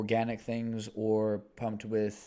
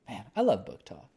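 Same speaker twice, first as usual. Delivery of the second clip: ordinary delivery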